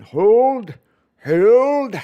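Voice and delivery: war-cry hold; Braveheart rallying cry. Shown as a demonstration. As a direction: roar it like a commander holding the line. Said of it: "That's my best".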